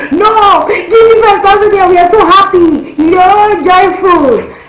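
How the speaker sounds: very high, loud, happy pleading; bubbling with joy, insisting; excited pleading. Retold or imitated. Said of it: "I was like".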